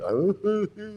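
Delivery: quiet breathy laugh; light chuckle; soft laugh. Real, not acted. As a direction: let a small laugh out. Laugh at own joke.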